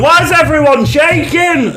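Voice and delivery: high, drawn-out clueless yell; hammed-up shout in a nightclub; oblivious shouting. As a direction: bellow slowly, as if unable to hear the music. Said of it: "my best friend's brother is deaf".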